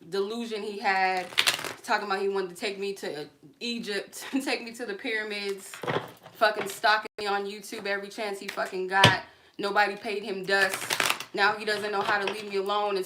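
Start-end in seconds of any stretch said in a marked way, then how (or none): none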